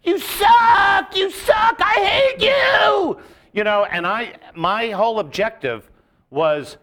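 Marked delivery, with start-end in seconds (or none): 0.0-3.2 very high, loud, jeering yell; crowd jeers. Retold or imitated. Comic mimicking hecklers screaming at him, loud and high. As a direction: scream abuse at the comic on stage. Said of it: "they're just going".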